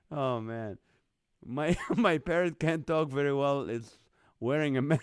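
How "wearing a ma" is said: louder, breaking off into real laughter; cracking up. Real, not acted. Host reading a viewer's mask joke, cracking up. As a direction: crack up before the word is out.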